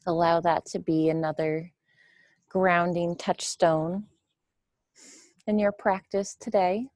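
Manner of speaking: measured meditation voice with long, spacious pauses; guided meditation. Real, not acted. Teacher guiding listeners to witness the breath, guided meditation. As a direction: guide them gently through the breath, leaving room between phrases.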